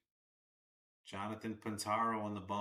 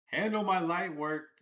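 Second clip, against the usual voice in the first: loud, raised-pitch cheer; excited shout; 8 semitones higher, 8 dB louder than usual. Real, not acted. Streamer reacting to the live at-bat, shouting. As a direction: call it out loudly at the game.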